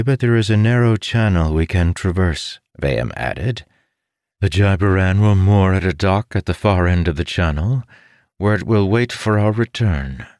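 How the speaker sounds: a hint of a learned woman explaining; lightly voiced scholar. A narrator's reading. Bayham briefing the war room.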